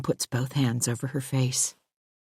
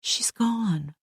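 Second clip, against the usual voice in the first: softer, calm reassurance; gently; 5 semitones higher, 5 dB quieter than usual. Acted. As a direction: settle her with a hush. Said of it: "I say gently".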